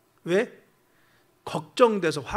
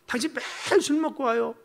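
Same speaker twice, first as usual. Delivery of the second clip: mock angry wife; 6 semitones higher than usual. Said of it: "화를 냈어요".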